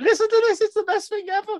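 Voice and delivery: very high, squealed retelling; hammed-up thrilled squeal; mock excitement. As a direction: imitate yourself gushing in a high voice. Retells excited call to wife.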